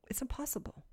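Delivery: breathy whisper, hushed right down; a dropped-voice whisper for weight; whispered emphasis. Real, not acted. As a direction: lean in and whisper it like a secret truth. Emphatic aside to congregation.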